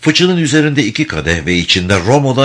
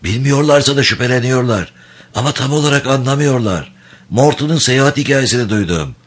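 higher grating rasp; 5 semitones higher than usual. Acted. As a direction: rasp it like a ruined throat. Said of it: "kulak tırmalayan hırıltılı bir sesle".

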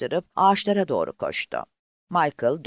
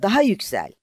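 high, loud urging, stress on "Daha"; eager loud repeat, "Daha" hit hardest; shouting on; 8 semitones higher, 5 dB louder than usual. A narrator's reading. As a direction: push "Daha yüksel!" out loud with weight on "Daha". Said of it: "diye bağırdı".

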